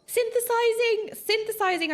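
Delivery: high, loud mock horror at the spelling; mock outrage. Streamer mock-horrified at the spelling synthesizing. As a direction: gasp it out as if scandalised.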